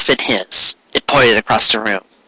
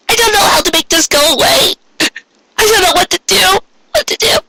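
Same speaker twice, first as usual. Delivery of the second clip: high, tearful, slowing; frightened crying. A narrator's reading. Pinkie tells Cupcake she cannot make it go away, high and crying. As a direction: sob it out high and lost.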